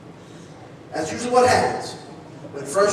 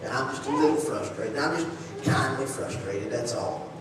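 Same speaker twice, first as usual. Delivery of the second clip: downplaying it; 7 semitones lower, 8 dB quieter than usual. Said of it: "We kinda take frustration to be like".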